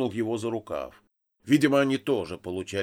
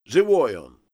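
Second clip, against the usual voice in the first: short loud call; loud, insistent reassurance shouted across; shouting; 8 dB louder than usual. A narrator's reading. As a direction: holler the report up to the boss. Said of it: "крикнул первый".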